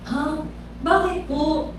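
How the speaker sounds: hushed timid surprise; small surprised question. Retold or imitated. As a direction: barely voice the puzzled reply. Surprised at overnight invitation.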